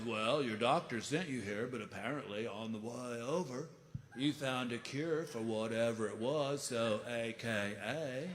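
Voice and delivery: lazy, amused hippie logic; stoner voice. Retold or imitated. Stoner explains.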